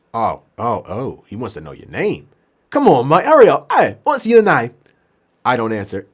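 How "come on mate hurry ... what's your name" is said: high, loud acted patient; impatient shouting. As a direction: hurry your mate along noisily. Patient hurrying his helper, repeated.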